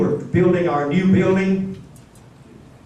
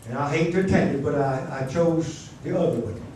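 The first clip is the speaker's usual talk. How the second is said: ordinary talk, nothing marked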